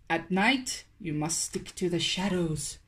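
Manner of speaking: a hint of a stern father warning; hushed fatherly caution; dad's warning. A narrator's reading. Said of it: "his dad warned".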